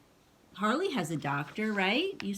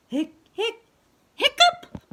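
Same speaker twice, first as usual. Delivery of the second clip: squeaky rising hiccup, pitched high; hammed-up hiccup; 14 semitones higher, 8 dB louder than usual. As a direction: burst up into a loud cartoon hiccup. Clifford's hiccup in the story.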